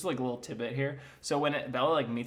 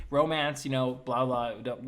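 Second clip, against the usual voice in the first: ordinary delivery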